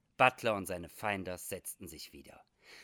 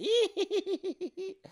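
very high, tittering hee-hee laugh; comic falsetto giggle in a rapid string; squeaky, impish cackle; merry giggle; 6 dB louder than usual. Acted. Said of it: "Bei diesem lustigen Lachen".

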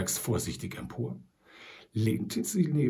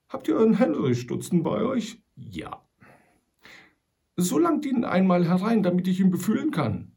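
put-on louder, higher, suspicious old trapper; 8 semitones higher, 6 dB louder than usual. A narrator's reading. Sam Hawkins distrusts the voice outside the hole, wary. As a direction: demand proof, gruff and wary.

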